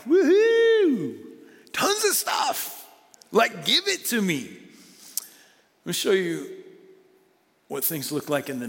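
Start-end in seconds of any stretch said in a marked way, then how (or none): 0.0-4.5 much higher, loud whoop, then slow greedy cry; greedy excitement. Retold or imitated. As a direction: cheer like a kid grabbing presents. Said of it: "I'm kinda like".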